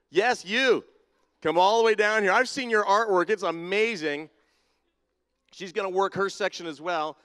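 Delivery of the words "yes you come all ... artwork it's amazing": loud, higher call across the church. Real, not acted. Pastor calling a painter down from the balcony, loud and higher. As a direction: shout her down to the front with enthusiasm.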